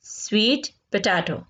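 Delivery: normal talking voice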